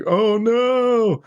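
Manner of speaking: hammed-up dying cry; mock villain cry. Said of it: "the Violator's like".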